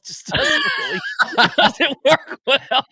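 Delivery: laughing. Real, not acted. Laughing at his own soap clip.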